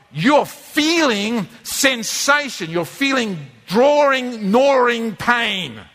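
slow, heavily drawn-out words; drawn-out intensity. Acted. Preacher describes cravings inside to congregation, slow and intense. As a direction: stretch each word like a gnawing ache.

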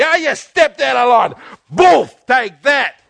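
loud, slow threatening shout; angry shout. Retold or imitated. An angry God to the sinner: threatening shout. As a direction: bellow it like a bully with a stick.

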